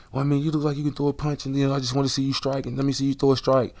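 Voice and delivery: high, fast eager voice of the guy; pushy sparring buddy talking him into a fight; imitating the guy. Guy pushing him to spar.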